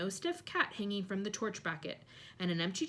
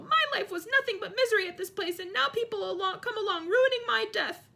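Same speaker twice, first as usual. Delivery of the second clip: high, loud ghostly howl; 13 semitones higher, 6 dB louder than usual. A narrator's reading. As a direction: howl it out, wounded. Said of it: "howled Myrtle".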